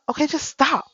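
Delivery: high, loud acted cut-in; exasperated intervention. Older daughter to mother mid-argument, exasperated. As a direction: call a halt, high and loud.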